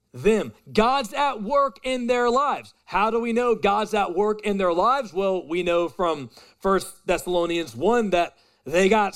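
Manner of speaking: loud, high preaching over music; full-force preaching. Acted. Pastor preaching hard to the congregation, loud and high. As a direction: preach it out with fire.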